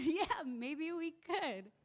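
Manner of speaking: very slow, gentle yes; tender agreement. Retold or imitated. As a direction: caregiver gently humoring an old friend, very slow. Tender agreement with dying woman.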